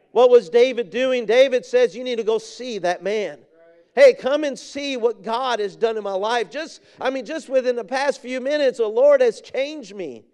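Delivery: high, urgent pulpit delivery; fervent preaching. Real, not acted. Preacher voicing new convert David to his friend, fervent preaching. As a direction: preach it as an eager call to come.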